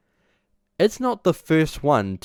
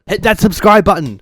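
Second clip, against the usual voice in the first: shouted plug, higher and louder; loud call out; 8 semitones higher, 9 dB louder than usual. Real, not acted. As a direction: belt out the subscribe plea. Outro call to subscribe.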